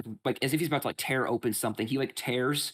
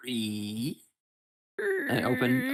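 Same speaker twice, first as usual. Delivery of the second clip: creaking door; 13 semitones higher, much slower than usual. Acted. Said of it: "Thank you, [name]. You did better".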